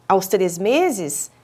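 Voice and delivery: normal talking voice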